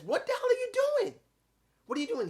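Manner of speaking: imitating Hathaway confronting Ethan Page in the ring; shocked manager. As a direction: yelp it like a startled sidekick. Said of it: "and he says".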